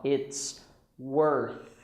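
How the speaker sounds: IT'S, then WORTH with a careful final TH; tongue out to end "worth"; clear TH. Shown as a demonstration. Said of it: "You have to put your tongue out".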